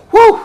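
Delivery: a real burst of excitement, a short whoop; excited whoop. Real, not acted. Dreaming about in-sync conversation, whooping. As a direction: let out a joyful woo. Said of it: "I gotta calm down".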